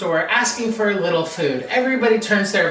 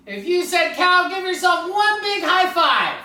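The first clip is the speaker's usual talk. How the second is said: ordinary delivery, nothing marked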